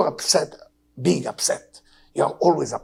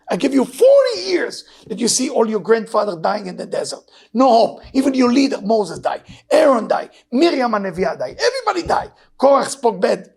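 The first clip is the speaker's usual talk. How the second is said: impassioned preaching; 5 semitones higher, 5 dB louder than usual. Acted. Preaching God's words to congregation.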